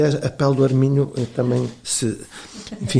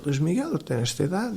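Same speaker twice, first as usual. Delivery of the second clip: mild fear, higher and hushed; retold fear; 4 semitones higher, 7 dB quieter than usual. Older colleague confiding before his defence, mildly fearful. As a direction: softly imitate a worried older colleague. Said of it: "tinha medo, dizia".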